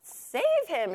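high, loud spider shriek; high-pitched cry for rescue; storybook shriek. A narrator's reading. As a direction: shriek it as an urgent plea. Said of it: "shrieked the spider".

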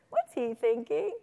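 slow question lifting at the end; teacher's prompting question. Shown as a demonstration. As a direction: draw it out with curiosity. Demonstrating question beside a character.

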